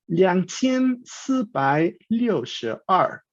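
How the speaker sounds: slow, syllable-by-syllable Mandarin numbers; Mandarin pronunciation demo. Shown as a demonstration. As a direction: sound out each Mandarin syllable slowly, tones distinct.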